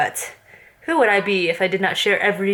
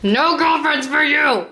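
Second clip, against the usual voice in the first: mock scolding; 7 semitones higher, slower than usual. Real, not acted. Mom scolding son playfully.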